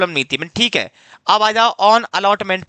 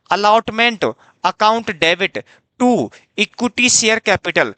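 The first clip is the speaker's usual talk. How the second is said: slow dictation; much slower than usual. Shown as a demonstration. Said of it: "याद रखना मेरे बाबू".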